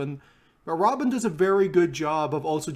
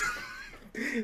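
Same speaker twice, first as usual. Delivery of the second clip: laughing; 12 dB quieter than usual. Real, not acted. Bursts out laughing.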